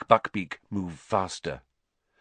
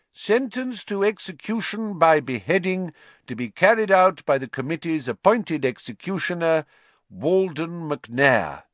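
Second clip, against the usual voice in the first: higher, louder formal proclamation; official reading a death sentence; 7 semitones higher, 3 dB louder than usual. A narrator's reading.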